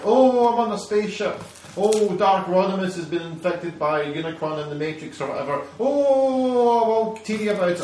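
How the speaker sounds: lightly hammed-up moaning; moaning whiner. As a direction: whinge like a self-pitying robot. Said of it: "he just fucking moans".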